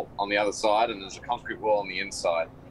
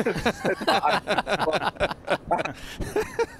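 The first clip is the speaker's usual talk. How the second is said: pained laugh; 10 semitones higher than usual. Real, not acted. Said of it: "my mouth is burning here".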